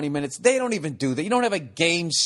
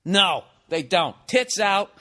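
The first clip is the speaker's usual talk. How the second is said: ordinary talk, nothing marked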